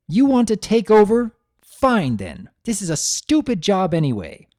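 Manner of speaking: loud, high snapping mother; furious mother yelling at her daughter; angry outburst. Acted. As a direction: throw the words at her like the bucket. Mother angrily hurls bucket.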